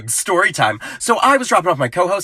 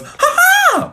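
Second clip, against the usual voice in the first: squeaky toy-dinosaur cackle, much higher; a tiny dino nugget laughing at you; 3 dB louder than usual. Acted. Dino nugget squeaking ha ha at Mom and Dad. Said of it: "dino nugget says it best when it goes".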